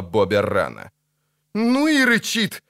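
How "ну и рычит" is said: high, drawn-out dismayed exclamation; high wincing complaint. A narrator's reading. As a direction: exclaim it high, appalled by the noise. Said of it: "поморщился Лютик".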